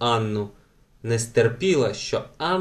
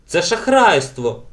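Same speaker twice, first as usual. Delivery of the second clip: higher, louder, drawn-out objection; indignant; 5 semitones higher, 5 dB louder, slower than usual. A narrator's reading. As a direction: protest slowly with indignation. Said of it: "обурилася Елен".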